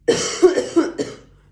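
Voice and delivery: loud sick cough breaking in; sudden hacking cough; coughing fit. Real, not acted. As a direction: hack through a cold. Sick speaker coughing mid-reading.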